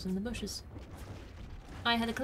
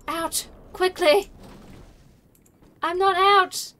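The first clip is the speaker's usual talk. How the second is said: high, loud, urgent yells; raised-voice outcry at being left behind; alarmed shouting; 7 semitones higher, 8 dB louder, slower than usual. Real, not acted. Locked out as train is stolen.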